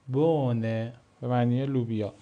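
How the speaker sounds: بونه with a long o and no h; silent German h. Shown as a demonstration. Teacher repeating the bean example for students.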